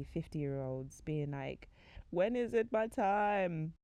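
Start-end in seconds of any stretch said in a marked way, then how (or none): 2.1-3.7 mock wail, loud and drawn out; exaggerated impatient plea; plaintive pleading. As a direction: plead slowly with raised pitch. Older singles' pleading messages acted.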